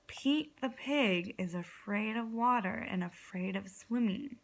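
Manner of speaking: usual speaking voice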